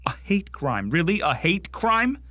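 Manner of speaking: incredulous, pitch climbing to a high questioning rise; scoffing, rising repeat; indignant disbelief. Acted. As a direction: throw the charge back in outraged disbelief. Acted monologue, indignant echo of charge.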